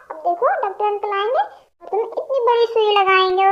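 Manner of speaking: usual speaking voice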